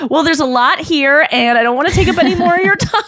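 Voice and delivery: high, quick, laughing reporter voice; mock polite reporter. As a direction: gush it out like an overly gracious reporter. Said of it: "the reporter's like".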